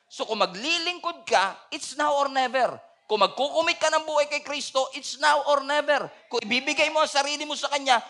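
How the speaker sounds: high, loud fervent preaching build; full-force preaching. Acted. Pastor urging the congregation, fervent loud preaching. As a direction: preach it with rising urgency.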